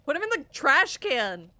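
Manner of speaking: loud laughing yell; shouting. Real, not acted. Yelling at creepy doll character.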